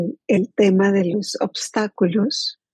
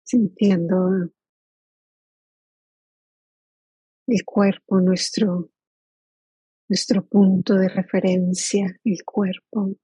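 guided meditation. Acted. Teacher inviting the group to settle into body and present moment, guided meditation. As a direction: lead them gently into stillness, leaving long silences.

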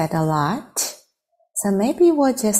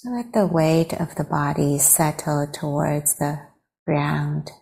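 guided meditation. Real, not acted. Teacher leading friends to arrive in the body, guided meditation. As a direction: guide them unhurriedly into stillness.

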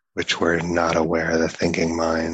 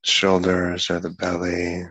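unhurried meditation guidance with long pauses; guided meditation. Acted. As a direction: ease them into relaxing the body, one part at a time.

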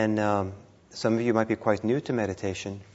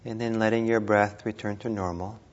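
guided meditation; 3 dB quieter than usual. Real, not acted. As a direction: ease them gently through each breath, unhurried.

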